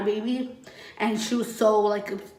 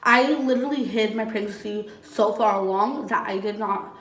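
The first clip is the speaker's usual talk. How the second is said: ordinary talk, nothing marked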